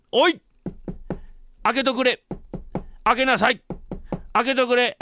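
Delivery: high, insistent calls at a shut door; calling out. Acted. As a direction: demand to be let in. Master calls at his shut door.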